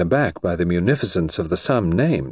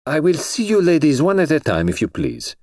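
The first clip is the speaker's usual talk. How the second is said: a lightly voiced foreign colonel, higher; formal and polite, lightly put on; 8 semitones higher than usual. A narrator's reading. The Colonel announcing interviews to the waiting girls, formal.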